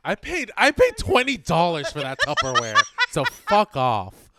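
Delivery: half-laughing, half-yelling about the price; loud amused indignation; laughing outrage. Real, not acted. Laughing outrage over Tupperware.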